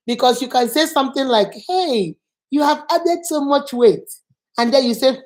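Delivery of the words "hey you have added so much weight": drawn-out, slow, lightly teasing greeting; friendly remark. Shown as a demonstration. Teacher showing a friend noting weight gain, friendly. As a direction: say it openly, with a slow lift on the greeting. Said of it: "You see the difference?".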